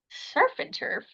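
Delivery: "Surf and turf?" whispered high, puzzled; incredulous echo. Retold or imitated. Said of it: "And I'm like".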